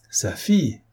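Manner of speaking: ordinary delivery, nothing marked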